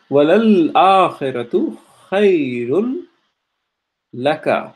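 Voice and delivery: fast melodic Quranic recitation. Acted. Teacher recites the verse to the class in chant. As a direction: chant the verse in tajweed style.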